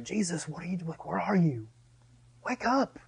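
soft, pleading call to wake up; soft desperate plea. Retold or imitated. Believer in a storm begging Jesus to wake, soft plea. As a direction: plead quietly upward.

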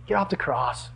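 low, soft tempter's voice; Satan. Retold or imitated. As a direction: quietly coax like a tempter. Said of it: "You can hear Satan".

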